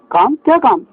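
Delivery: irritated tailor asks what he wants; angry question. A narrator's reading. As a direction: snap back at the mouse. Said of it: "दर्जी ने गुस्से में पूछा".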